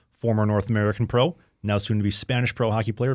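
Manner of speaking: normal talking voice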